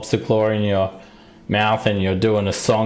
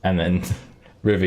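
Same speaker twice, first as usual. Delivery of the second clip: ordinary talk, nothing marked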